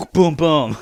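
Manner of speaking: loud punchy boom booms; mock gun sounds; imitated gunshots. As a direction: mimic shotgun blasts. Imitating shotgun blasts.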